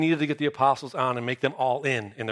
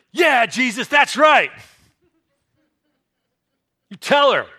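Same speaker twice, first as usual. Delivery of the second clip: loud, high cheering disciples; 8 semitones higher, 6 dB louder than usual. Retold or imitated. Disciples egging Jesus on, excited shout. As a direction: cheer him on like a fan.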